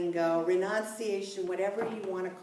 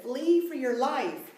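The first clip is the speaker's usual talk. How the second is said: higher, louder, slow warning; urgent angel; 6 semitones higher, 3 dB louder, slower than usual. Retold or imitated. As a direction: warn them to run for their lives.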